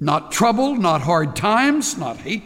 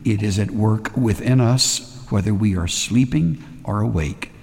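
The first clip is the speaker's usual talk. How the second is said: lower, hushed, unhurried prayer; closing prayer; 8 semitones lower than usual. Acted. Pastor praying over the congregation, low and hushed.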